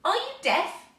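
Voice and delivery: high, loud irritated rabbit; rather cross. A narrator's reading. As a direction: snap it at the staring children. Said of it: "rather crossly".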